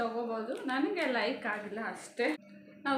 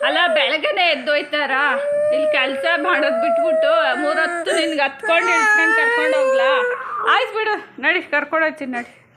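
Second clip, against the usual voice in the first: loud exasperated calming; 3 semitones higher, 12 dB louder than usual. Real, not acted. Mother calming her crying son.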